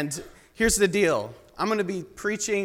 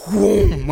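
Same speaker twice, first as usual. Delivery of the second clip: whoosh dodge noise; 9 dB louder than usual. Retold or imitated. I'm like Matrix style.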